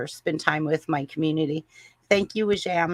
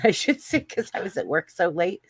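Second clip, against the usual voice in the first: ordinary delivery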